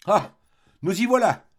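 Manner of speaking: loud, high exclamation, cutting in; indignant cry. A narrator's reading. Old maid interrupting the artist who wants distraction, crying out. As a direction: burst in as if you'd seen it coming. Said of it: "s'écria-t-elle en l'interrompant".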